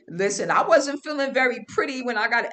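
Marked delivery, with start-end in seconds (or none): none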